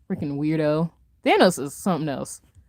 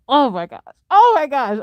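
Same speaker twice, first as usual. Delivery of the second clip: surprised; 6 semitones higher, 4 dB louder than usual. Real, not acted. Reacting to chat comment.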